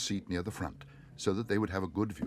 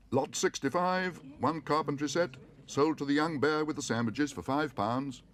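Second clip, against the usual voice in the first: auctioneer announcing; 7 semitones higher, 4 dB louder than usual. A narrator's reading. Auctioneer announces the bear's purchase. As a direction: announce it to the whole hall.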